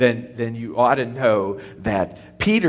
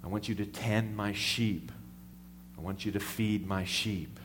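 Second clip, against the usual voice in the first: soft, gentle commission; tender charge; 5 semitones lower, 10 dB quieter than usual. Acted. Jesus charges Peter by the seashore, soft and tender. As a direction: hand it over quietly and tenderly. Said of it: "and he says".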